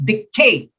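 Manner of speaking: TATE punched in "dictate", light first syllable; second-syllable stress. Shown as a demonstration. Teacher sounding out the answer, "dictate" stressed on second syllable. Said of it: "Second syllable".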